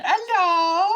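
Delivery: bright high hello to the cat; sing-song hello to a pet; high pet greeting. Real, not acted.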